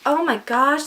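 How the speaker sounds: usual speaking voice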